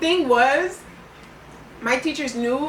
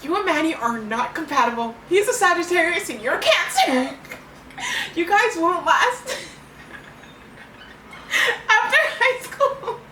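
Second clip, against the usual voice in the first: quoted teacher; 6 semitones higher than usual. Retold or imitated. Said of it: "She used to tell me these things".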